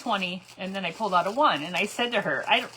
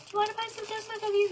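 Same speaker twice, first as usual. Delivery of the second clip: put-on high, quick little girl sales pitch; 14 semitones higher, faster than usual. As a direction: chirp it like an eager little Girl Scout. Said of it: "she's like".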